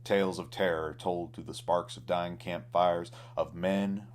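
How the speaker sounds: ordinary delivery, nothing marked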